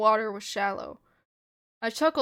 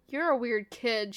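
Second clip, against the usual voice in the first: ordinary delivery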